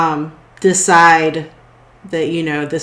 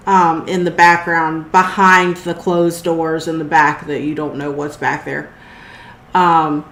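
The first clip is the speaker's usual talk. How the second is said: normal talking voice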